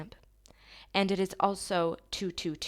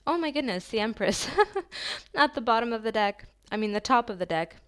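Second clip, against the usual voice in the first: thrilled, laughing, louder and higher; giddy laughing exclamation; excited laughter; 4 semitones higher, 5 dB louder, faster than usual. Real, not acted. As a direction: burst out delighted at the card. Thrilled at Empress card drawn.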